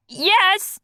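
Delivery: high small creature voice; ferret voice. A narrator's reading. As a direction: pitch up for the ferret. Ozzy voiced behind door.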